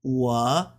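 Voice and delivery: "wo" low with a rise at the end; third tone. Shown as a demonstration. Demonstrating for learners, "wo" in low dipping third tone. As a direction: hold "wo" low, then lift it. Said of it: "Third tone".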